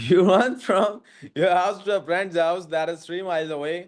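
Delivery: slow, put-on English accent after a throat clear; changed English accent. Shown as a demonstration. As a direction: read it in a deliberately different English accent. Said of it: "अचानक accent भी बदल गया".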